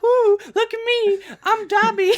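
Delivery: goofy attention-seeking villain; mock show-off. Fan mimics Dabi dancing around, very high goofy squeal.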